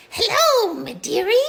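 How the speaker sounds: high, loud cackling witch greeting. Acted. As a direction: croon it like a storybook witch. Witch voice scares young girl.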